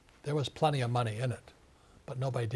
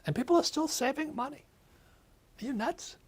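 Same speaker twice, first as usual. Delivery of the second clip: incredulous; 13 semitones higher than usual. Real, not acted. Incredulous rant about savers.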